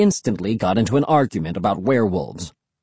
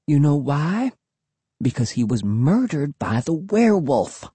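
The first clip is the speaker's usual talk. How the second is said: put-on spooky girl; 4 semitones higher than usual. A narrator's reading. Cassie spooky claim.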